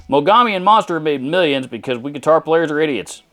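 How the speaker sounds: mocking a commenter in a put-on Texan accent. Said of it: "if you hear me break it out to make fun of something".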